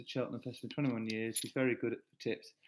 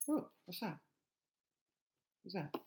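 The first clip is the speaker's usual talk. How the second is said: talking to the dog; 6 semitones higher, 5 dB quieter than usual. Real, not acted. Talking playfully to her dog.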